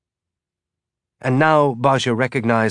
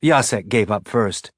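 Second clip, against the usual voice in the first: ordinary delivery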